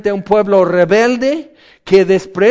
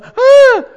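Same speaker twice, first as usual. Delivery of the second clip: high, loud panicked scream; scream at a charging dog; 10 dB louder than usual. Shown as a demonstration. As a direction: shriek as a snarling dog runs at you. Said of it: "un perro que está corriendo hacia ti".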